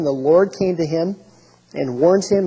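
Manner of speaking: ordinary delivery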